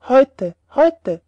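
loud, punched shout; jeering call after him. A narrator's reading. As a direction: shout it after the fleeing seller. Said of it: "riefen sie ihm nach". (lautes Nachrufen)

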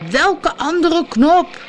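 high, slow, drawn-out call; stretched-out, high-pitched shout; shouted question. A narrator's reading. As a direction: yell the question back over engine noise. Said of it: "riep Tom".

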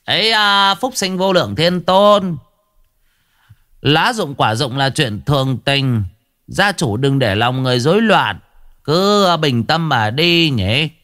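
high, ringing sorcerer's chant; sonorous ritual master calming the family; resonant priest voice. A narrator's reading. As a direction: intone like a village exorcist. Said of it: "cất giọng sang sảng".

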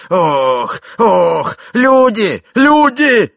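groans building to high, loud shouts; panicked calling. A narrator's reading. Old man as wife pales, panicked shouts for servants. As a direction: shout for help, climbing in pitch.

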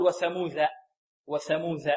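وثموذ ending in DH as in "this"; wrong د as ذ. Shown as a demonstration. Teacher shows a common error, DH sound in وثموذ. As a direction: soften the final د into ذ, "wathamudha". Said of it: "لا نقول".

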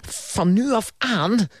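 slow, high pompous proclamation. A narrator's reading. Uncle proclaiming an ultimatum to his niece, pompous. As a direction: declare it grandly, drawn out. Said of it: "verkondigde hij hoogdravend".

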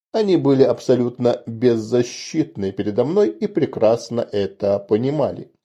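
ordinary delivery

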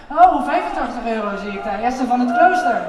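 loud auctioneer call. Acted. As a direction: announce the bid loudly.

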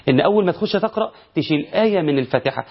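ordinary talk, nothing marked

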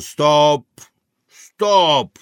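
loud, abrupt stop command; boatswain's order. Acted. English boatswain to the Italian, loud command. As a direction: call a loud halt, twice.

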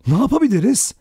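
high, helpless question; hopeless. A narrator's reading. Vera has no plan for the night, hopelessly. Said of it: "Vera umutsuzca".

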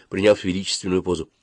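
ordinary delivery

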